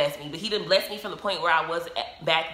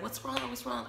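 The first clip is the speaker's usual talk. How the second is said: higher, softer mock-concerned onlookers; imitated concern; 6 semitones higher, 8 dB quieter than usual. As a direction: imitate worried people asking gently. Retold concerned onlookers asking her.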